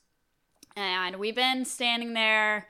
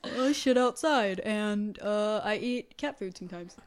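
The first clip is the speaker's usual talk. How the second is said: voicing the pet. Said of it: "voicing the pet".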